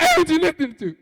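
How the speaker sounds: cracks up high and loud; nervous squeaky blurt; the awkward line that actually came out; voice cracking high. Retold or imitated. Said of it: "when it came out".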